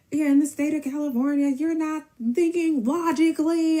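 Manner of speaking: high, strained, stretched-out holler; loud hammed-up rant, high-pitched; mock yelling. Mimicking his yelling on phone.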